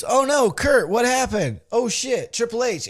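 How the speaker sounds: high, loud shocked outburst; hammed-up dismay at a fallen friend; acted alarm. [name] discovers Kurt is down.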